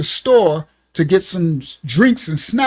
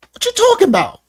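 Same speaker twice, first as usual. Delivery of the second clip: high, loud, breezy denial; playing it off; 4 dB louder, faster than usual. Hiding his trouble from a friend, high breezy denial. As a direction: brush it off with a grin. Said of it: "I got a smile on my face".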